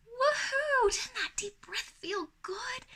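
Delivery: high thrilled whoop, then hushed slow praise; happy praise. Shown as a demonstration. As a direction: cheer the kid on, then drop to a delighted whisper. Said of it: "Give them lots of happy words and encouragement".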